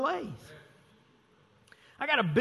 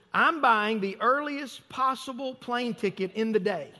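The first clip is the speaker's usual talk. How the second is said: ordinary delivery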